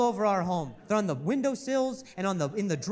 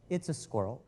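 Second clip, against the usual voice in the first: deadpan, much softer and lower; flat squirrel reaction; 6 semitones lower, 6 dB quieter than usual. Shown as a demonstration. Comic showing how he reacts to squirrels, deadpan and soft. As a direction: say it flat and unimpressed. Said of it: "here's how I react whenever I see a squirrel".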